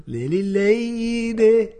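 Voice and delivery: "Lady" drawn out slowly in a Mexican accent, pitched high. Shown as a demonstration. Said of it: "trying to do a good Mexican accent".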